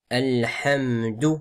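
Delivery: slow "Alhamdu" with the L held; careful "Alhamdu", lam and mim drawn out; clear lam. Shown as a demonstration. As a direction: sound the L fully in "Alhamdu". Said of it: "Il faut bien prononcer le lam".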